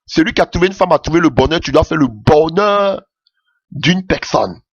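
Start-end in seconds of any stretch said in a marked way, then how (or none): none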